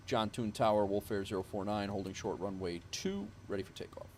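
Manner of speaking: usual speaking voice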